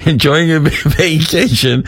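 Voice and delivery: giggling mid-sentence; fighting back laughter while reading; laughing through words. Real, not acted. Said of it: "try and keep a straight face".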